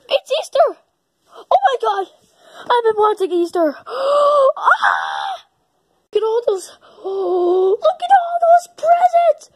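gasping, high excited squeals; thrilled. Acted. Character discovering the Easter presents, thrilled. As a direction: burst with delight.

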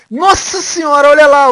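high, very loud astonished cry; startled exclamation. Real, not acted. Player spots something sudden, loud startled shout.

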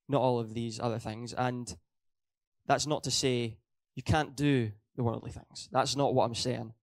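ordinary talk

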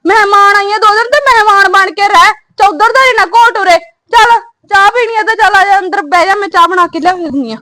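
loud scolding. Acted. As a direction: tell the visitor off at full volume. Sister-in-law scolds visiting guest. (ਉੱਚੀ ਆਵਾਜ਼ ਵਿੱਚ ਝਿੜਕਾਂ)

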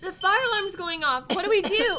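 high and loud, calling over the alarm; panicked shout. Acted. Sibling panics as the fire alarm goes off, loud panicked shout. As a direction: yell in panic.

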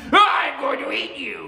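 high, furious shout; fed-up ogre roar. A narrator's reading. Oni who has had enough of stabbing, furious roar. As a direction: explode in one loud outburst. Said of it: "finally the Oni had enough".

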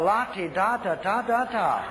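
slow sing-song mockery; mocking la-di-da. Real, not acted. Preacher mocking a churchgoer's excuse with sing-song. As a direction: taunt the pride, slowly.

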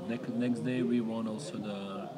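ordinary talk, nothing marked

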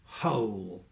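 soft, breathy held-back voice; odd voice. A narrator's reading. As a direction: swallow the anger, barely voiced. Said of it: "he said in an odd sort of voice".

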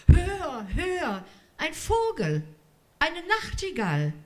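hoch und aufgeregt, wie ein entzücktes Kind; aufgeregtes Kind. A narrator's reading. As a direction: ruf es begeistert, höher und mit Staunen. Kind hört begeistert die Geige. (Mascha hört die Geige, hoch und begeistert)

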